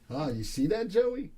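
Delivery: ordinary talk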